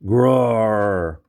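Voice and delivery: loud beastly roar; furious creature roar; monster roar. A narrator's reading. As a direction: let out a monstrous bellow. Monster roars.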